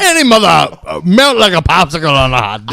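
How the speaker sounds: loud, high Southern accent, hammed up. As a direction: belt it out like an over-the-top Southerner. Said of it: "Got real southern in there".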